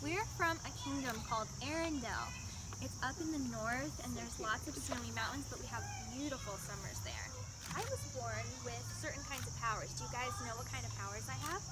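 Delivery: put-on soft, gentle princess voice talking to children; storytelling queen speaking softly to kids; Elsa character voice. Plays Elsa at a kids' party.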